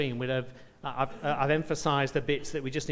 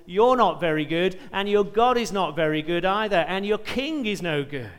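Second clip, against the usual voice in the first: loud and higher, mocking the defenders; jeering taunt; 4 semitones higher, 8 dB louder than usual. Assyrian commander jeering at the people on the walls, loud mockery. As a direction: sneer it up at the walls, building scorn. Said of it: "the Assyrian commander is effectively saying".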